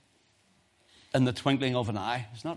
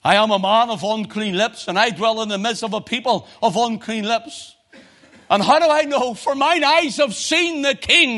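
anguished cry; 8 semitones higher, 8 dB louder than usual. Acted. Isaiah crying out before God, loud and anguished. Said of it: "and he cries".

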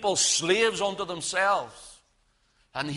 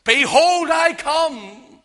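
loud, high pulpit cry; ringing shouted proclamation; proclaiming shout; 9 semitones higher, 7 dB louder, much slower than usual. Acted. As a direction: cry it out as Christ's warning. Preacher proclaims Christ's warning cry.

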